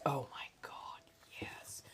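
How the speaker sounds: whispered craving, fading to nothing. Real, not acted. Dreaming of spiced chai, an unvoiced whisper. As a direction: breathe it out like a guilty pleasure.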